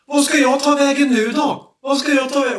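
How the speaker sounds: high, desperate imitated plea; high-pitched panicky cry; panicked. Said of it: "man hör ju liksom paniken i honom".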